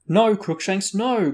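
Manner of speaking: high, loud cry of protest. A narrator's reading. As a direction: call out to stop a misbehaving pet. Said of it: "cried the witch".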